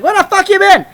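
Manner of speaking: high, loud mock mobster yell; mobster's shout. Mobster truck driver calls out to approaching movers, mock shout. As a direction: holler it like an impatient mobster. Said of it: "pulled up like some mobster".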